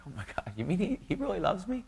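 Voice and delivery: rising, awestruck disbelief; retelling his own amazement; astonished wonder. Retold or imitated. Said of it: "I'm like".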